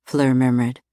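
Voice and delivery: usual speaking voice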